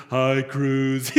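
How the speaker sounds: imitating a crowd's drawn-out hello; lightly mocked chorus of sympathy; sympathetic group greeting. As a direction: greet him like a pitying support group. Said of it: "they're feeling his pain".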